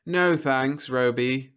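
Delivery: mildly bored brush-off; yawned. A narrator's reading. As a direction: turn the robot down with a touch of a yawn. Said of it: "the young woman yawned".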